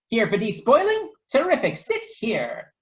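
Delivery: high, loud chirpy greeting; Professor Biscotti greeting. Acted. Biscotti greets her customers, high and chirpy. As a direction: welcome them brightly. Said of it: "she said".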